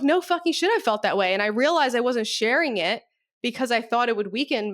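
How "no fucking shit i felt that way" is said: sharp high burst on the first words, then settling; emphatic agreement. Retold or imitated. Telling a client she felt it too, emphatic. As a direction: blurt out hearty agreement. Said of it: "And I'm like".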